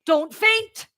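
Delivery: high, urgent order; urgent warning. Acted. Friend heading off Maisie's faint, high urgent warning. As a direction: plead with her in alarm.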